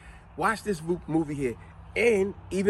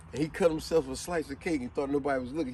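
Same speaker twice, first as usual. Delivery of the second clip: normal talking voice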